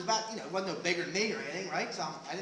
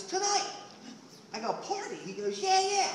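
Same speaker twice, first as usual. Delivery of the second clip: high, excited yeah yeah; mock-thrilled hermit; eager; 14 semitones higher than usual. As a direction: sell him on the party. Eager party invitation exchange.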